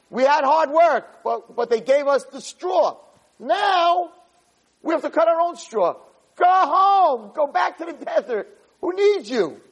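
high-pitched retold outrage; indignant complaint. Overworked people turn on their leader Moshe, indignant. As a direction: act out fed-up workers sending someone packing.

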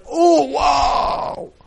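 high, drawn-out wow of amazement; awestruck. Acted. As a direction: act out being overcome with awe. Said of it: "I'm awe".

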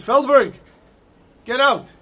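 loud, high screamed command; screamed order. Retold or imitated. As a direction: shout it as a commander ordering a prisoner out. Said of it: "he starts screaming".

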